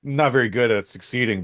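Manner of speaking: usual speaking voice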